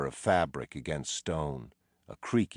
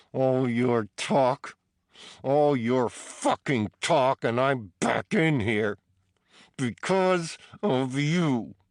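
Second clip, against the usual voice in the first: higher, louder, slow and seething; bitter furious prisoner; 7 semitones higher, 5 dB louder, slower than usual. A narrator's reading. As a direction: grind out every word of blame. Battered prisoner blames Teddy.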